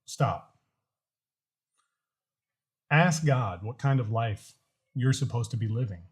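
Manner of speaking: usual speaking voice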